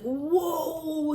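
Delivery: mimicking his stunned reaction at dinner; stunned whoa. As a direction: act out being floored. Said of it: "He's like".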